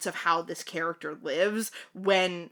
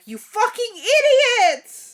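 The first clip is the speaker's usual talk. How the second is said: high, drawn-out shout of mock outrage; mock outraged yell; 9 dB louder, slower than usual. Imagined listener berating the clueless hosts, mock furious yell. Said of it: "Somebody's gonna be like".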